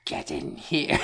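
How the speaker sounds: raspy, high villain yell; strained, hissing cartoon-villain call; Cobra Commander. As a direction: screech an order for an underling. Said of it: "Cobra Commander will be yelling for you".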